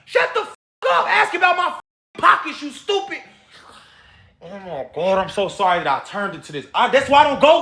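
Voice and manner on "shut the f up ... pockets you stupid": real anger. Real, not acted. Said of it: "'cause I'm so mad right now".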